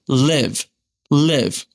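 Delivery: "live" with a brief, lax i; short i. Shown as a demonstration. Teacher giving learners an example, short i in "live". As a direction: keep the vowel in "live" short as in it. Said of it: "they say it very short".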